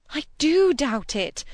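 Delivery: high, loud and slow defiance; fresh energetic outburst. A narrator's reading. Cynthia throws Preston's love back at him, bursting with fresh energy. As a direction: break out emphatically, leaning on each word. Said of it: "breaking out with fresh energy".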